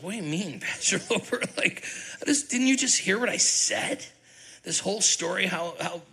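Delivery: retold protest at the pastor, high and exasperated; exasperated disbelief. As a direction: plead in laughing disbelief. Retelling his own exasperated protest.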